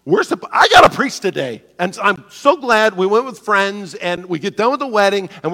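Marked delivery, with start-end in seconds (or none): none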